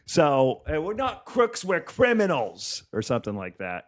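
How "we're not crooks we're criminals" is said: higher, slow mock Australian; bad Australian accent. Australian heckler answers the comic, bad Australian accent. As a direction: repeat the heckler in a badly faked Australian accent. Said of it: "I don't do accents".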